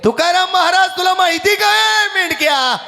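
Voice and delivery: high, strident challenge; angry scolding wife. Acted. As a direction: berate him at a high pitch. Jijabai मेंढक्याला रागाने दटावते. (रागाने, चढ्या आवाजात दटावत)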